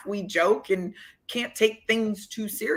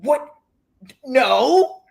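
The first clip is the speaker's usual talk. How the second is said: high, louder startled whats; flustered denial; 7 semitones higher, 6 dB louder, much slower than usual. Retold or imitated. The player flustered at her accusation, high startled denial. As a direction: deny it, caught off guard.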